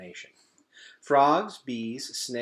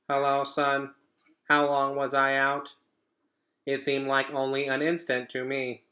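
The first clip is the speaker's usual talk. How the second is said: robot mother's familiar voice; 4 semitones higher, 5 dB louder than usual. Acted. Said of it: "her familiar voice returned".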